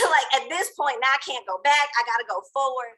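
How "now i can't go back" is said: ordinary talk, nothing marked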